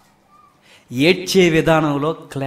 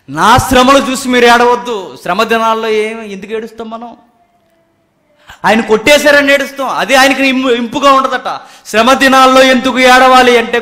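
loud, raised-pitch sermon delivery; forceful preaching; 7 semitones higher, 10 dB louder than usual. Real, not acted. Preacher tells congregation why to weep, forceful preaching. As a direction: drive the point home to the congregation.

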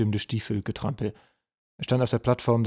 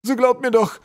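very high, loud desperate plea; begging; 9 dB louder than usual. A narrator's reading. Prisoner troll begs the general to believe him, desperate. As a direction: beg frantically to be believed. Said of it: "bettelte der Packtroll".

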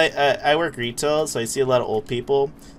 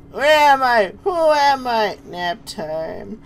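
put-on high, loud lost old-timer; bewildered elderly customer; confused old person; 11 semitones higher, 6 dB louder, much slower than usual. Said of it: "they look like they don't know where they're at".

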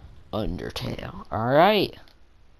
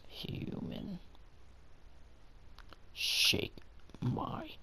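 breathy spooky whisper; eerie whisper; 7 semitones higher, 12 dB quieter, much slower than usual. Acted. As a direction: whisper it like a hidden stranger.

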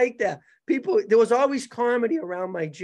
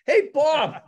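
loud, higher shout across the gym; calling out; 7 semitones higher, 5 dB louder than usual. Retold or imitated. Gym owner calling Bob to the phone, loud holler. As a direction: holler for Bob to come over.

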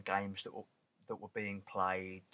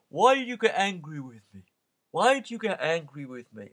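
higher, louder wounded protest; seller's mock hurt; 6 semitones higher, 9 dB louder than usual. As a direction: make the tourist feel guilty. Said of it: "and then".

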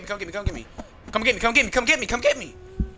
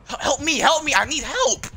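panicked pleading; 7 semitones higher, 5 dB louder than usual. Real, not acted. Being chased, begging for help.

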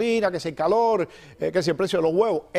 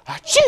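a sudden, high, exaggerated sneeze; loud mock sneeze; 4 dB louder than usual. Imitating a shopper sneezing in the supermarket, loud comic sneeze.

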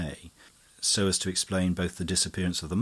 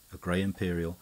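usual speaking voice

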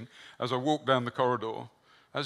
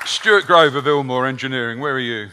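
loud call across the room; 13 dB louder than usual. Real, not acted. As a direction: call out to find him. Calling out to guest in room.

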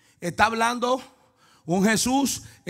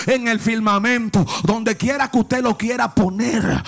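preaching at full force; 3 semitones higher, 4 dB louder than usual. Acted. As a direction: chant it out, climbing to a roar over the music. Preacher sings out the sermon. (predicación cantada y a gritos, cada vez más aguda)

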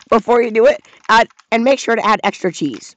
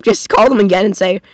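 normal talking voice

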